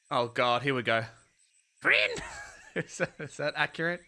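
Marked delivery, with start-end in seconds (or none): 1.8-2.2 high, squeaky character cry; high-pitched call. Acted. Game character hails a friend by name, high-pitched.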